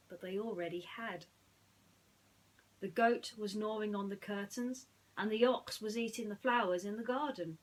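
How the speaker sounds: put-on bright read-aloud story voice for children; lively storybook reading; storytelling narration. A narrator's reading. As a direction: tell it like a bedtime storybook.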